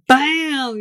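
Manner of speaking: sudden storytelling bang; mock explosion. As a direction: punch it out like a sudden blast. Mimics the explosion in retelling.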